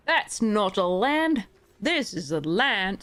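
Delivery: slow, drawn-out mock Australian accent; bad Crocodile Dundee Australian accent. The player jokes to viewers in a bad Australian accent. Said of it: "the worst accent ever".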